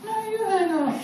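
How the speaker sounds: doting mock sweetheart voice; lovesick phone banter. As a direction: coo it like a smitten teen on the phone. Lovers' phone banter acted out.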